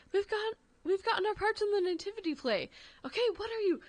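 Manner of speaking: put-on excited kid and mom. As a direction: pitch it up like an excited child. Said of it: "that Love Actually, uh, quote".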